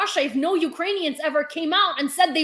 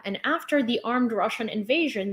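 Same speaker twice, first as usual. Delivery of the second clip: ordinary talk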